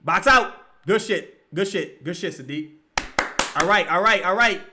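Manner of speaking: ordinary talk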